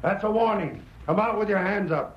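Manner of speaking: high, forceful shouted warning; shouting. Acted. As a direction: bark the warning across the cave. Warning shot, calling out.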